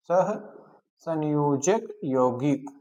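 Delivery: "सहसंयोजक यौगिक" drawn out slowly with breaks between parts; slow, word by word. Shown as a demonstration. Teacher repeating a new term for students, "सहसंयोजक यौगिक" slow and broken up.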